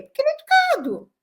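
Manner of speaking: normal talking voice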